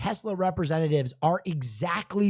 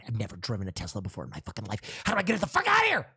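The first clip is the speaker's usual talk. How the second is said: frantic rush; 8 semitones lower, 5 dB quieter, much faster than usual. Retold or imitated. Panicked inner monologue.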